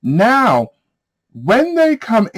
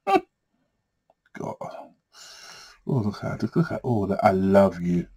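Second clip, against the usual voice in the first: hushed giggle; 10 semitones lower, 8 dB quieter, slower than usual. Real, not acted. Giggling over juicy cards.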